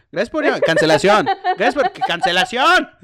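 loud greeting breaking into laughter; laughing through it. Retold or imitated. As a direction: repeat the greeting while cracking up.

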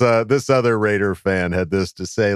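ordinary delivery

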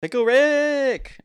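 high, loud, slow shouted Pickle Rick; shouted catchphrase. Pretend. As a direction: yell it like a cartoon catchphrase.

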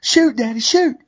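very high squealing plea; imitating an excited little girl. Girl begging her dad to shoot, squealing high. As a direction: pitch it way up like a thrilled child. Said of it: "you can hear her in the video saying".

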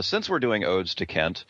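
ordinary delivery

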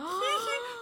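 high, sheepish little giggle; mock giggle. Caught teen giggling at a friend's mom. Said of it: "caga de la risa".

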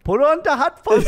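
put-on Swedish accent. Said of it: "Is that, is that your Swedish accent?".

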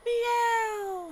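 high, small mock meow; a preacher mocking the devil as a house cat; tiny cat meow. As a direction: make the devil a harmless kitten.

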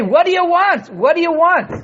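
high, loud "What do you want?"; impatient. Acted. Teacher voicing an impatient listener to Moshe, high and loud. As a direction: demand it, out of patience. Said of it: "you say".

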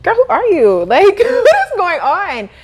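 high, loud laughing disbelief; laughing surprise. Retold or imitated. Woman amazed at her new teacher voice, high laughing disbelief. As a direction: laugh at your own new self. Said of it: "I was like".

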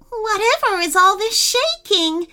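high worried squeak; anxious little bat voice. A narrator's reading. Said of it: "asked a worried Bitsy".

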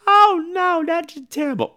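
high put-on young woman's voice; high girlish voice. Danielle Harris's character asks Tony Todd, high girlish. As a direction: ask it wide-eyed in a high voice. Girl reacts in dialogue.

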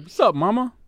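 put-on cocky little boy voice; kid greeting. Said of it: "he be walking in the house like".

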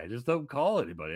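ordinary delivery

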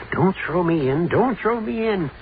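mock yell, rising as it repeats; retold yell. Watchman retells the sleepwalker's cry as a mild mock yell. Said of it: "yells something about".

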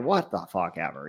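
low, quiet offhand mutter; dismissive. Retold or imitated. Podcaster voicing two unimpressed deckhands, dismissive.

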